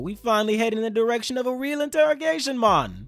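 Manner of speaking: loud, gruff-edged interrogator taunting the prisoner; a booming interrogator who relishes the moment; Ibiki's voice. Retold or imitated. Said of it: "that's how Ibiki sounds in my head".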